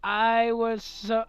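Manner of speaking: ordinary delivery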